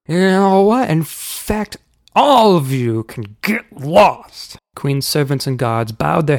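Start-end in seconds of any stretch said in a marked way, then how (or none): 0.1-4.6 high, loud opening, then drawn-out insult; angry uncle. A narrator's reading. Uncle tells the whole roomful of worms off, angry. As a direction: stretch each word in rising indignation. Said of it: "mistaking Uncle Shameless's anger".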